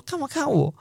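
confused, almost squeaky; puzzled question. Retold or imitated. Puzzled that strangers stare at them in school. Reacting to being watched.

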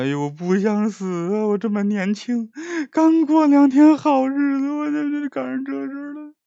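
high, wailing self-pity, close to tears; whiny mumbling lament. Acted. Prisoner Qian Jin whimpering to himself in his cell, high wailing lament. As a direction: whimper it like a scared young man crying. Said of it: "这还这嘟囔呢".

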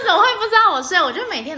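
high, loud disbelief; incredulous. Real, not acted. Host exclaiming to co-host in loud disbelief. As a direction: exclaim how could he not know.

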